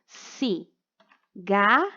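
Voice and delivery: cigarra drawn out as three isolated syllables; long gaps inside cigarra: ci, gar, ra. Shown as a demonstration. Teacher dictating for the child to write, cigarra in slow separate syllables. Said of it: "tem três sílabas".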